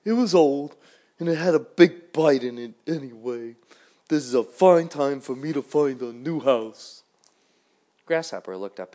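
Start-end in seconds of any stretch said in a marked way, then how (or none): 0.0-7.0 high, loud little worm, slow at the end; a fussy worm grumbling about its old home. A narrator's reading. Worm complains to Grasshopper about losing its house, high and loud.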